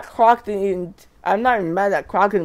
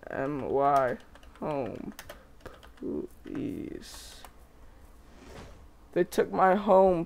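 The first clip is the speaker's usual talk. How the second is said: much softer and lower, halting words; faint broken pleading; 3 semitones lower, 9 dB quieter, slower than usual. Acted. Dinosaur tells its attacker they took its home, soft and broken. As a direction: barely get the words out, shattered.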